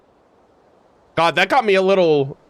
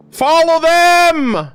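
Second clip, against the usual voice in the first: higher, louder and slow, pleading with the screen; urgent shout; 9 semitones higher, 5 dB louder, much slower than usual. Real, not acted. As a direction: yell it out, dragging each word. Urging characters on screen.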